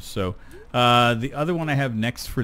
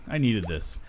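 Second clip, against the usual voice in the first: ordinary delivery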